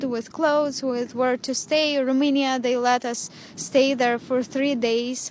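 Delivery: ordinary talk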